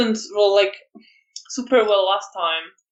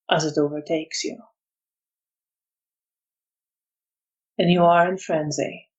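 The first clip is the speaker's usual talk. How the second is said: measured scene-setting with long pauses; game master's narration; 5 semitones lower than usual. Acted. Game master tells player the frenzy takes hold, weighty narration. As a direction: let each phrase land before going on.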